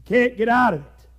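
ordinary talk, nothing marked

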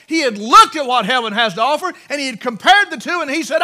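fervent preacher crescendo; preaching at full force. Acted. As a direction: climb toward the shout. Preaching builds to climax.

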